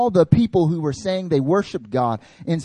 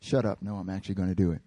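blunt dismissal; 9 semitones lower, 7 dB quieter, faster than usual. Retold or imitated. Jesus brushes disciples off.

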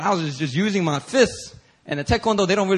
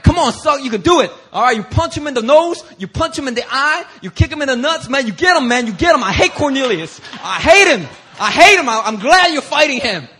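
higher, louder excited cheering; hyped-up friend; 6 semitones higher, 4 dB louder than usual. Retold or imitated. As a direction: pump him up before the fight. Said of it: "He was like".

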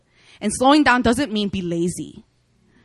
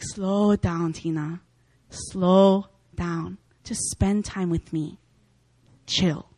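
soft voice of God; 4 semitones lower, 5 dB quieter, much slower than usual. Retold or imitated. God gently telling Tina to slow down, soft and low. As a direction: lower and softer, slow and tender. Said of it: "And he was just like".

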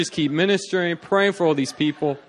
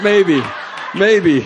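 loud, raised exclamations; yelling; 5 semitones higher, 8 dB louder than usual. Retold or imitated. Preacher yelling out every spiritual keyword, loud call. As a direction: call each word out like a keyword. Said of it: "I was yelling out".

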